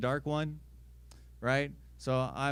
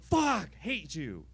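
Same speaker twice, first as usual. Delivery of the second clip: mock hateful outburst; 3 semitones higher, 4 dB louder, much slower than usual. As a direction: curse like an offended cat. Cat cursing its owner.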